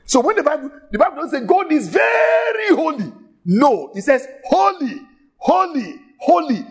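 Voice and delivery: holy cried out high each time, falling; emphasis on holy. Shown as a demonstration. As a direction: build the threefold holy to a peak. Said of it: "they use repetitions for emphasis".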